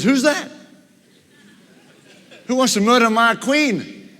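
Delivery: high, sharp outrage from the king; the king demanding who dares, voice leaping up; outraged king. Acted. King hears of plot on queen.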